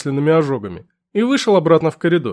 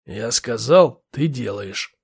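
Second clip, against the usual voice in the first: gruff lieutenant; 6 semitones lower, much slower than usual. Acted. Lieutenant voiced.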